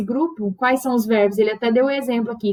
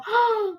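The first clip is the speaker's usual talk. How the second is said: sharp high gasp of fright; high startled intake of breath; frightened gasp; 11 semitones higher than usual. Shown as a demonstration. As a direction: gasp as if suddenly scared. Teacher acting out being scared.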